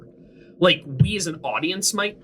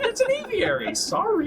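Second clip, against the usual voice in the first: high-pitched mimicry of a correction; mocking imitation. Teasing a friend over the word aviary, mocking imitation. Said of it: "Why did you say it like that?".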